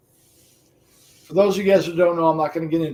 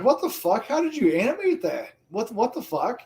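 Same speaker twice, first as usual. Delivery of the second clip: retold disbelief; 7 semitones higher than usual. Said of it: "I was like".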